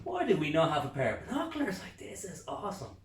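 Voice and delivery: high, loud burst of delight; excited. Retold or imitated. Man with binoculars to himself, thrilled and loud. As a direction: blurt it out thrilled.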